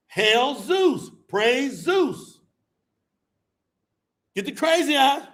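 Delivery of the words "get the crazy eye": ordinary talk, nothing marked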